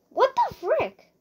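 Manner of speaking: high-pitched, drawn-out disbelief; startled outburst. Real, not acted. Player caught off guard in game, startled outburst. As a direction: exclaim in shrieky disbelief.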